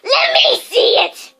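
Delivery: high, loud slow shout; demanding. A narrator's reading. Dudley demands to see the letter, shouting. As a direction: demand it, bellowing. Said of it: "demanded Dudley".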